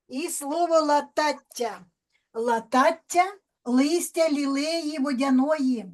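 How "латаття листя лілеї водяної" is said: ordinary delivery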